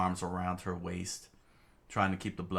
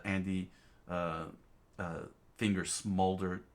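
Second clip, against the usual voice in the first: plain reading voice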